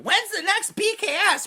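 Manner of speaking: very high mock fan whine; pestering fan. Host mimics fans spamming about the show, high pestering voice.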